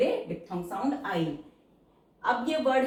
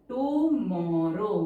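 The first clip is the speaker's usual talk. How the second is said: tomorrow stretched very slowly, syllable by syllable; slow writing-speed tomorrow, vowels held long; drawn-out dictation; much slower than usual. Shown as a demonstration. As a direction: draw out each syllable of tomorrow.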